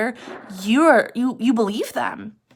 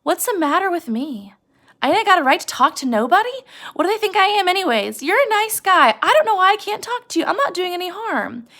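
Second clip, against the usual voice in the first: high, rising, pleading complaint; Curly's wife pleads with Lenny to let her talk; lonely Curly's wife; 9 semitones higher, 4 dB louder than usual. Acted. A high, pleading, lonely complaint to Lenny. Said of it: "She's bored and she's lonely".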